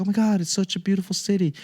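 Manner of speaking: lightly hammed-up delighted wife; gushing wife. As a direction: rave about the city like a charmed tourist. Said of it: "She's like".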